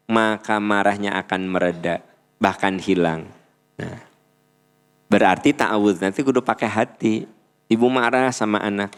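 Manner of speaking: normal talking voice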